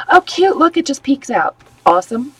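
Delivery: high, delighted gushing; delighted cooing. Real, not acted. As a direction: coo over something adorable. Admiring her craft.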